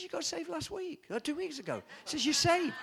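pastor insisting. Retold or imitated. Pastor tells boy he's saved.

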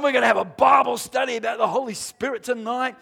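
ordinary talk, nothing marked

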